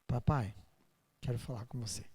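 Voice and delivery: put-on soft, hushed little-child voice; a touch of childlike tenderness; tender child plea. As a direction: ask like a small child to his dad. Child coming to Jesus.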